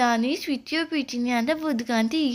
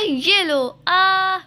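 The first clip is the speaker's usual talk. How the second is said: acted fight shout; 4 semitones higher, 6 dB louder, much slower than usual. Acted hitting and cry.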